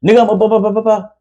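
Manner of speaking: high-pitched blah-blah scolding; mock yelling. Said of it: "I start here yelling".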